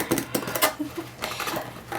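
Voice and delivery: quiet airy laugh; breathy giggle. Real, not acted. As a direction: giggle under your breath. Finds extra items in box.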